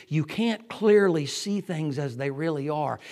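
loud, ringing you can't clearly see; full-force preaching. Real, not acted. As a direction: proclaim it to the back row. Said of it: "first statement".

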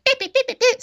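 high-pitched rapid beeping; mimicked nagging beeps. Imitating a customer's chatter to a coworker, mock beeping.

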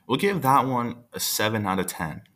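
plain reading voice

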